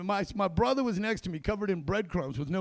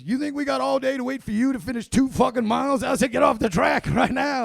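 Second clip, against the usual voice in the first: loud, higher barked order; yelling drill sergeant; 5 semitones higher, 7 dB louder than usual. Retold or imitated. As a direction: chew out the last runner. Drill sergeant yelling at slow recruit.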